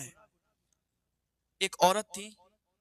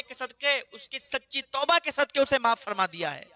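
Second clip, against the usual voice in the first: angel's message; 6 semitones higher, 4 dB louder than usual. Acted. Jibreel rebukes Moses, delivers pardon.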